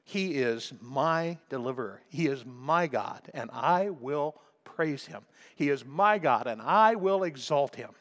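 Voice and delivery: usual speaking voice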